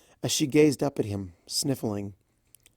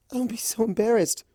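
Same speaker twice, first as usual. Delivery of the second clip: timid voice; 11 semitones higher than usual. Acted.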